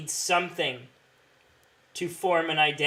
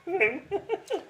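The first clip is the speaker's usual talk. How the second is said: high laugh. Real, not acted. Laughing at impression.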